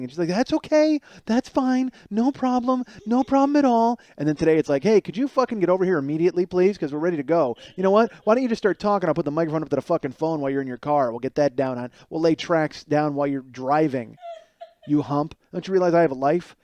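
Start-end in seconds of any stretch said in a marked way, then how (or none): 0.3-4.0 much higher, louder sugary agreement; sing-song eager reassurance; mock cheery high voice. Mocking Lily's cheery agreement. 4.8-16.6 hammed-up impatient nagging; mock demanding. Mocking Lily demanding.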